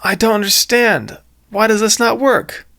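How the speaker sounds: high, baffled story voice; puzzled character. Acted. Villain puzzled, story dialogue.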